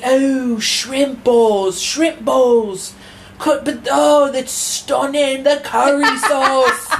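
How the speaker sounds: high, slow gushing British impression; mock British accent. Mocking British TikTokers raving over curry sauce in a British accent. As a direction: drawl it like a dazzled British foodie. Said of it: "mocking British food TikTok".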